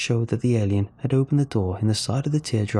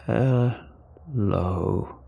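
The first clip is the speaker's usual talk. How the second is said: low gravelly alien. A narrator's reading. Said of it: "the alien said in a low, gravelly voice".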